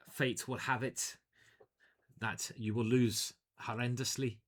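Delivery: put-on doom-laden Irish storyteller accent, lightly done; mock Irish prophecy accent; vague Irish accent. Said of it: "I don't know what accent I'm doing there".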